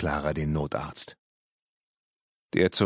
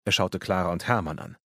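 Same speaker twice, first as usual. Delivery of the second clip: usual speaking voice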